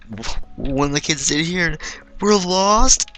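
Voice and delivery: put-on high, loud child yelling we're lost; kid's panicked cry. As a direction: cry it out like a scared kid. Kid blurts out they're lost.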